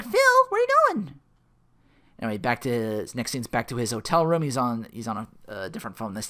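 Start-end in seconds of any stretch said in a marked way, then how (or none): 0.0-1.2 very high, loud mock call; calling after someone. Rita calling after Phil as he walks off, high and loud.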